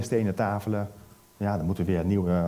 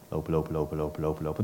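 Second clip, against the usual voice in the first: fading repetition; 6 semitones lower, 5 dB quieter, much faster than usual. Acted. Moses walking far away.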